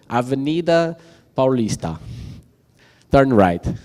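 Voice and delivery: put-on stilted, word-by-word English-accented navigation assistant; English-accented GPS voice. Speaker mocks the phone navigator reading a street name, English accent. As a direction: mimic a navigation voice mangling Portuguese with an English accent. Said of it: "falando com sotaque inglês".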